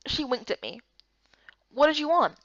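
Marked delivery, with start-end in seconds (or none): none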